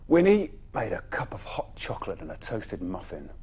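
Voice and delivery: usual speaking voice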